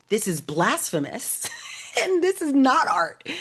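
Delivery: slow, scandalised, laughing through it; half-laughing indignant quote; mock outrage. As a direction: play the offended curator with a laugh. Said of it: "The other curators were like".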